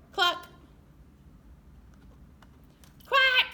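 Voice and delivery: high, loud cluck and quack; animal noises. Acted. Teacher voicing farm animals while reading. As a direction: squawk it up high for the kids.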